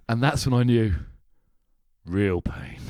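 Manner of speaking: weighty delivery, sinking on real pain; solemn hammed-up punchline; mock-dramatic. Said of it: "No, wait, that's not good enough".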